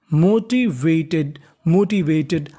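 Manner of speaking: Motivated drawn out syllable by syllable; teacher's slow model reading; slow model word. Shown as a demonstration. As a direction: sound out Motivated very slowly. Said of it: "teacher models the word slowly".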